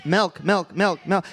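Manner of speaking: "milk" with a Midwest vowel, repeated four times. Shown as a demonstration. Comic repeating his Midwest "milk" to the crowd. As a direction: say "milk" over and over the Minnesota way. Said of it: "I'm just gonna say it twenty times".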